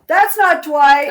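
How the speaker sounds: loud, high, drawn-out mock protest; outraged fan comment. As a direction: voice an indignant online commenter, slowly. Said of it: "someone writes".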